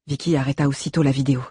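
plain reading voice

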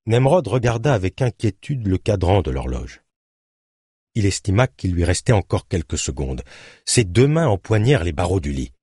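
usual speaking voice

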